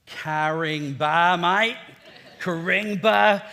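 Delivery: loud, drawn-out put-on Aussie accent; best Aussie accent. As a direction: stretch it slowly in a broad mock Aussie accent. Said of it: "mustered up my best Aussie accent".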